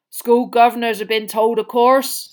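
big man lamenting heavily; Hagrid, miserable. A narrator's reading. Gamekeeper says governors know, miserable. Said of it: "said Hagrid miserably".

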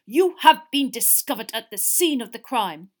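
loud, half-mad declaration; furious accusation. A narrator's reading. Crouch with popping eyes, loud mad accusation. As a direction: denounce them forcefully. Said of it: "He looked slightly mad".

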